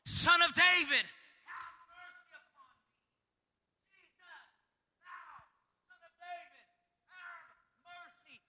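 high, strained plea trailing off soft; pleading cry. Acted. Blind Bartimaeus begging Jesus, high pleading cry. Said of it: "he cried out all the more".

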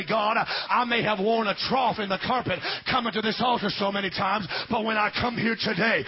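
high, loud prayer building to a shout; rising shouted preaching. Acted. Pastor voicing a congregant's prayer at the altar, shouted preaching. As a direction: pray it aloud, climbing to a cry.

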